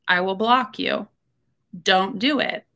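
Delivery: ordinary talk, nothing marked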